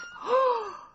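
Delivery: a sudden high gasp of shock; startled gasp; sharp gasp. Acted. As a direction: gasp as the cat trips her. Startled by the cat.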